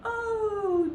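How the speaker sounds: high, long wailing cry while flying; a big bear yelling as she soars; screaming polar bear. A narrator's reading. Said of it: "screamed the polar bear".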